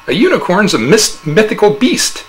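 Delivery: a touch of smug innocence; innocent denial. A narrator's reading. Husband feigns innocence.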